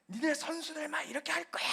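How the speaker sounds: high, fast mock tirade at the players; furious coach. A steamed coach yelling at his players, mock fury. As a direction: blow up at lazy players like a coach. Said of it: "감독이 완전히 스팀 받아가지고".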